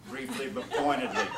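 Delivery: usual speaking voice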